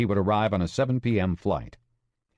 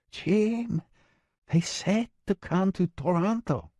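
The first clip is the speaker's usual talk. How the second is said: Maria's insistent voice; 11 semitones higher, slower than usual. Acted.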